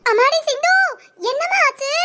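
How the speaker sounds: high, worried put-on voice; acted alarm. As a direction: pretend to panic over Sindhu. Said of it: "இதுவும் நடிப்பா?". (நடிப்பு பதட்டம்)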